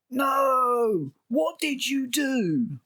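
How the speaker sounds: high, drawn-out mock horrified cry; mock Wendy outcry. Reviewer imitating Wendy confronting Joe, mock distraught. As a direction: wail it slowly, hammed-up and high. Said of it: "And then Wendy's like".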